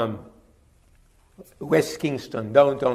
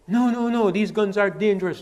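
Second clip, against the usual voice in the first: higher, louder mock protest; insistent objection; 6 semitones higher, 6 dB louder than usual. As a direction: imitate relatives objecting emphatically. Retells relatives' objection to guns.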